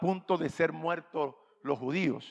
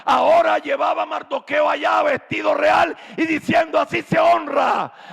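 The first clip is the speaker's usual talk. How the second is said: loud, high preacher's proclamation, slowing; preaching at full force; 7 semitones higher, 9 dB louder than usual. Acted. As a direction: proclaim it to the congregation.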